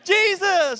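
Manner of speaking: high, loud exclaim; awed recognition. Pretend. Newcomer in heaven recognizing Jesus, high awed exclaim. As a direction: gasp out the name in awe. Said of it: "And they'd be like".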